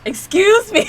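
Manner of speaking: loud, high-pitched, incredulous outburst; mock affront. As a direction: react as if wildly offended, half joking. Affronted reaction to the character.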